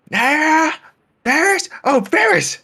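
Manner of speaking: high, loud calling out; yelling names. Retold or imitated. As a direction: call the names loudly. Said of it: "Why are you yelling?".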